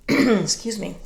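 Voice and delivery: throat-clearing cough after a sip; choking on coffee. Real, not acted. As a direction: talk through choking on a drink. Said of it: "So I'm choking on my coffee".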